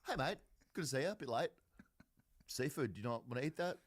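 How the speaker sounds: quick, cheeky rattle; fast teasing. Retold or imitated. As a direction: tease him at a quick clip. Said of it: "So I go".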